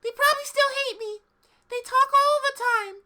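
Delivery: squeaky high cartoon girl; high girly cartoon voice. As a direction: pitch way up, bright and bouncy.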